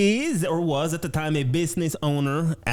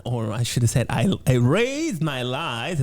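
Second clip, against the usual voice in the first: ordinary talk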